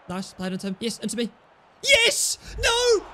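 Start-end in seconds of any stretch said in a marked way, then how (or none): 1.8-3.0 high, loud hope dashed; yes then no shout. Real, not acted. Gamer watching a chance go wide, shouting. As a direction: yell joy then despair.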